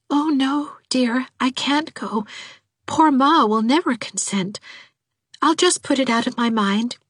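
high-pitched, self-denying daughter voice; timid high voice, sadly giving in; meek daughter, resigned. A narrator's reading. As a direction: gently give up your dearest wish.

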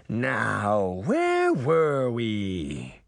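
very slow, drawn-out sinister voice; slow villain drawl; menacing villain. A narrator's reading. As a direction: savour it like a villain. Villain Mirror resumes attack.

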